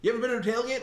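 high, loud mock American; exaggerated American accent. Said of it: "this does sound like an American".

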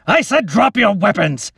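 loud, high furious roar; captain roaring at his soldiers to drop their weapons; angry roar. Acted. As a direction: roar it at them in rage. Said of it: "the captain roared in anger".

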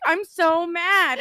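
high, loud and slow imitation of a drunk friend; hammed-up drunk griping, words dragged out; mock drunken anger. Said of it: "super drunk".

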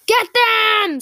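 loud, high, angry attack order; enraged yell. A narrator's reading. Captain orders another attack, enraged yell. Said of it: "this time with rage in his tone".

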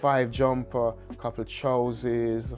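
usual speaking voice

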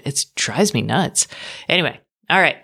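normal talking voice

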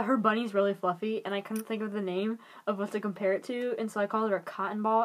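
ordinary talk

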